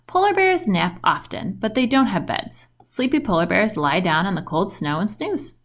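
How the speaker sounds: ordinary delivery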